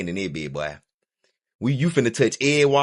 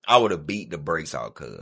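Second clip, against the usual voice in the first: ordinary talk, nothing marked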